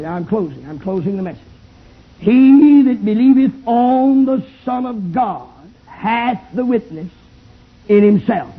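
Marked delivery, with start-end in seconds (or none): 2.2-8.6 slow, weighted scripture reading. Shown as a demonstration. Preacher reading a verse slowly to the tent crowd. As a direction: read each word out slowly. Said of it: "I'm gonna read it very slowly".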